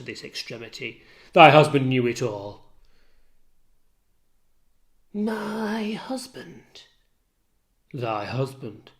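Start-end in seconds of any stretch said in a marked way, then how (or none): 5.1-6.8 slow breathless disbelief; stunned whisper. Acted. Maid stunned to hear her husband named, whispered. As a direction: let the words fall out in shock.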